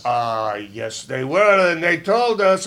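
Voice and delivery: normal talking voice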